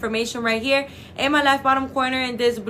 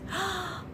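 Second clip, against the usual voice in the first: sharp high gasp of delight; excited gasp; 8 semitones higher, 12 dB quieter than usual. Real, not acted. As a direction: gasp as if the news just hit. Reacting to surgery being soon.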